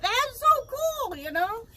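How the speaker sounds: delighted exclaim; thrilled. Real, not acted. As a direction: gush about how cool it is. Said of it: "still thrilled".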